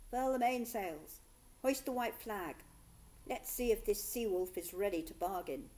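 loud barked ship's orders; ordering. Acted. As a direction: command the crew, loud and firm. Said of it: "the captain ordered".

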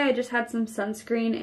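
muffled by rubbing fingers; talking while washing face; hand partly over mouth. Real, not acted. Seen 0: Cleanser rubbing; hand covers mouth at start, again at 3.6-3.9.

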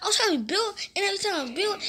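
high-pitched angry ranting; getting mad. Real, not acted. Gamer venting to viewers about losing, heated high rant. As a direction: let the anger spill out fast. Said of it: "I'm getting mad".

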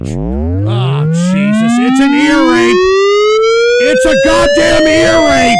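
real enraged yelling at the troll; furious screaming. Real, not acted. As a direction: scream over the blaring clip to kill it.

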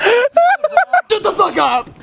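high, drawn-out yell through laughter; laughing shout. Real, not acted. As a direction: yell it slowly, cracking up. Bickering loudly amid laughter.

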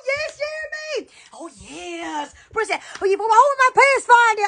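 usual speaking voice

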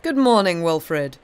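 a hearty colonel's good morning; amused greeting. A narrator's reading. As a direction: hail him heartily, with amusement. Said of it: "laughing, to speak to him".

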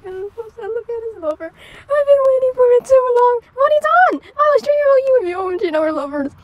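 high, loud mock sobbing confession; hammed-up tearful gush; mock crying. Crying to his lover, mock tearful. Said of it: "started to cry harder".

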